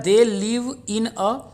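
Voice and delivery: word by word, very slow reading; slow dictation. Shown as a demonstration. Teacher reading the exam question to students, very slow dictation. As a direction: dictate it so students can write. Reads exam sentence aloud.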